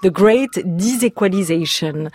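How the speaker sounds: English term in a real French accent. Real, not acted. Interviewer quoting the author's English term to him, French accent. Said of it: "je vais pas écorcher vos oreilles avec mon accent".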